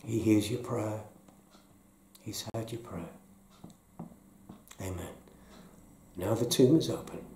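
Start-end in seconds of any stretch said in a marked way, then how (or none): 0.0-5.1 quiet. Real, not acted. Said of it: "I'll just be quiet".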